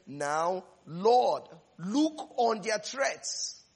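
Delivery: a loud Lord, then a slow deliberate plea; slow dramatic scripture. Acted. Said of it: "the Bible says".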